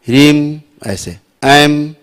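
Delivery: ह्रीम ending on a lips-shut m, then एम alone; demonstrating ह्रीम and its m; closed m ending. Shown as a demonstration. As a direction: show the closing hum of ह्रीम. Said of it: "मुंह बंद हो गया".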